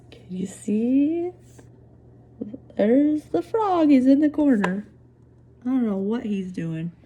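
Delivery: usual speaking voice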